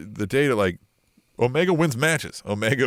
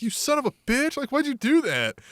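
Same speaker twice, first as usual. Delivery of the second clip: high-pitched mock indignation; pitched-up play-acted accusation; acted outrage; 12 semitones higher than usual. Acting out Omega discovering the betrayal.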